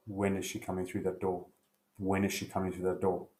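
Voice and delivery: low, quiet, brooding mutter. Acted. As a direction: simmer it under your breath. Husband brooding at the sink.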